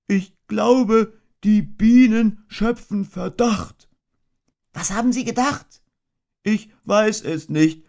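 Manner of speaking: a little bear anxiously asking, then shrugging; worried Pooh. A narrator's reading. Pooh and boy talk bees.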